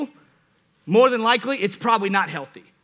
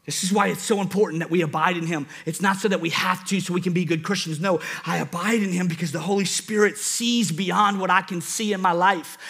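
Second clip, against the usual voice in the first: ordinary talk, nothing marked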